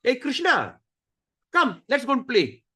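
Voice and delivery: put-on high, eager little boy calling out; playful child. Cowherd boy calling Krishna to come play, high and eager. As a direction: call your friend out to play. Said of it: "then he said".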